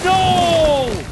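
long, high "no"; dismayed cry. Real, not acted. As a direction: wail it as the plan fails. Things go wrong in fight.

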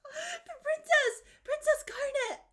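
high, loud cry of recognition; astonished cry. Acted. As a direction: call her name in stunned delight. Character recognizes the princess.

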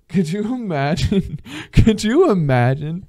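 high, drawn-out incredulous question; laughing disbelief. Real, not acted. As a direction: laugh through it, loud and stretched out.

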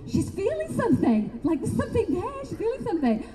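high amazed mock inner voice with a laugh; awed mock whisper-thought. Intimidated, imitating the awed room.

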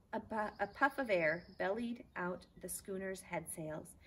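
plain reading voice

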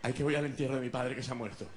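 low, mournful monotone; mildly hammed-up grief, low voice; sad friend. Friend going to his father's funeral, sad. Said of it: "Lo veo triste".

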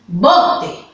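slow "bote" with the weight on "bo"; stress on BO. Shown as a demonstration. Teacher telling the pupils how they should say "bote". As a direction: draw out "bote" slowly and lean on "bo". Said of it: "cuando tú digas esta palabra".

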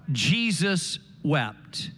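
very slow, low and hushed; the shortest verse said slowly and low; solemn scripture. Acted. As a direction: let each word hang with reverence.

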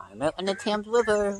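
ordinary delivery

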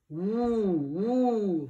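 long howling ooh, ooh; roaring wind. A narrator's reading. The storm wind brushing the window, howling ooh. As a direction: let the wind moan like a wolf. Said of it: "rugit le vent".

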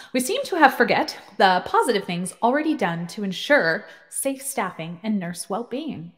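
ordinary delivery

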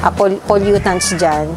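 ordinary talk, nothing marked

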